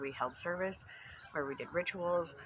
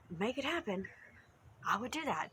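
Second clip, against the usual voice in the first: normal talking voice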